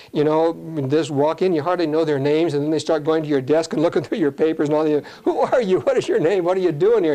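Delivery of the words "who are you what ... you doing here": sharply raised, alarmed questions to an intruder; startled challenge. Retold or imitated. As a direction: ask who they are, higher and startled. Homeowner confronts pushy intruder.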